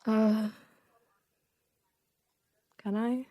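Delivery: usual speaking voice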